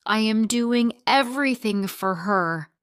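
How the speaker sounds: angry shout. A narrator's reading. Husband shouting back at wife in a fight. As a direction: fire it back at her.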